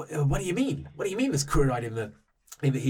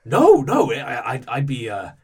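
flustered denial; 3 semitones higher, 9 dB louder than usual. Acted. Kith denying blame to the player, loud and flustered. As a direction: protest loudly, then gush. NPC denies, protests.

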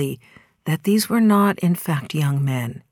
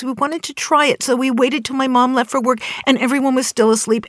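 lightly voiced young boy, pitched higher and hurried; boys pleading; 7 semitones higher, 3 dB louder than usual. Teenage boy explaining to the lady why they took the car, higher, a touch of a kid's voice. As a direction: speak up like a nervous kid explaining himself.

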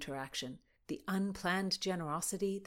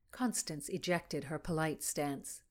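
ordinary delivery

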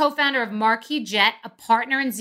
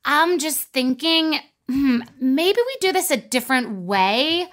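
passive aggressive stalling; 2 semitones higher, much slower than usual. Shown as a demonstration. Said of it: "Be like".

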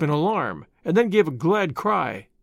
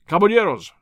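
a louder barked order to his men; sharp command; 4 dB louder than usual. A narrator's reading. Zorro orders his caballeros to seize Don Carlos, commanding. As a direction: call the men to action with authority. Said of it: "the last word was one of command".

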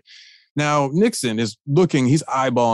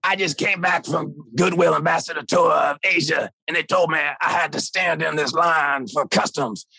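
lightly imitated gravelly jazzman, laid back. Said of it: "with his distinctive gravelly voice".

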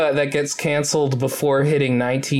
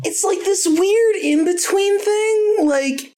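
high squeaky voice; slower than usual. Acted.

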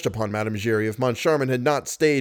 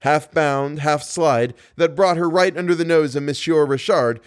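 usual speaking voice

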